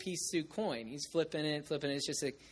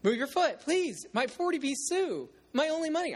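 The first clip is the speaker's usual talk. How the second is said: put-on pleading boy; 12 semitones higher, 3 dB louder than usual. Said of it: "so he comes up and goes".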